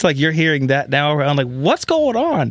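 usual speaking voice

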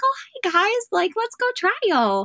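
put-on very high, drawn-out girly innocence; mind-game innocence. Competitor greeting the guys as a mind game, faux-innocent. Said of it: "a little bit of as a mind game".

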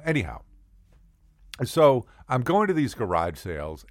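ordinary talk, nothing marked

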